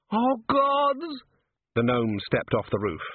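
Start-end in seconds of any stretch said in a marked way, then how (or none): 0.1-1.2 very high wail of dread; panicked. A narrator's reading. Sergeant dangling as the gnome prepares to jump, panicked. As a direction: wail it high, drawing out the end. About to fall from roof.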